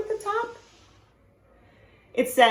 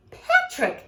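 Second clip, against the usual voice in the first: very high, sing-song granny call; high granny voice calling Patrick. Acted. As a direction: call out like a sweet old grandma. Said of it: "Granny said".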